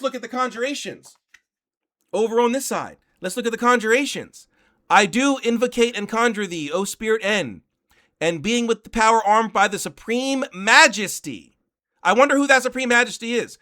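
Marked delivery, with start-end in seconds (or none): 4.9-11.6 louder, ceremonial conjuration reading; solemn reading. Acted. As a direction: intone the conjuration with weight. Reads conjuration aloud.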